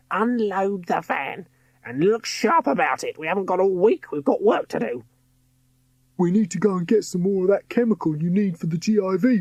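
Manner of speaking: high commanding villain voice; bossy villainess. A narrator's reading. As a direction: order the henchman about.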